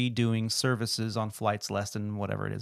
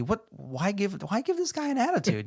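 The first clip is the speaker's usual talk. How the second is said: incredulous; 8 semitones higher than usual. Real, not acted. Outraged rhetorical question.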